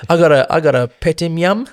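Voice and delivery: put-on a proud kid showing off his snack. Voiced schoolkid answering.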